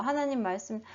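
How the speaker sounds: usual speaking voice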